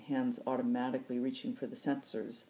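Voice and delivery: ordinary delivery, nothing marked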